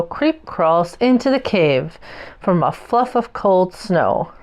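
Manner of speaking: usual speaking voice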